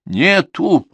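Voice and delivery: drawn out, insistent. Acted. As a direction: lean on "Нету!" stubbornly. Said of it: "протяжно настойчиво повторил внук".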